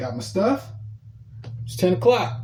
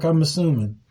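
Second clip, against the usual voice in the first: normal talking voice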